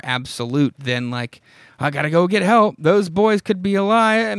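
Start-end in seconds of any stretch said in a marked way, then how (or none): 1.8-4.4 high-pitched imitated panic; mock urgent cry. Mocking Bobby's last line of the issue, imitated panic. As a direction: parody a desperate call for help.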